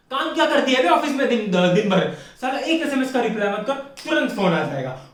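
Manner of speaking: ordinary talk, nothing marked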